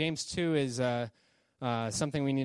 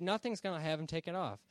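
ordinary delivery

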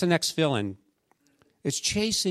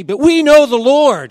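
shouted rallying cry, high and loud; 8 semitones higher, 13 dB louder than usual. Retold or imitated. Coach shouting to her girls in the huddle, a rallying shout. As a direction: belt it out to fire up the team.

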